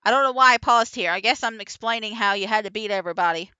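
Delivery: ordinary talk, nothing marked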